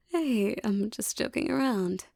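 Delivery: normal talking voice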